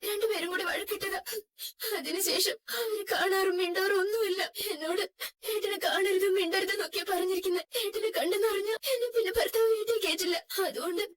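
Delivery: high, tearful sobbing telling; weeping. Acted. Wife telling the driver why she cannot see her brother, sobbing. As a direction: cry through the story of her brother.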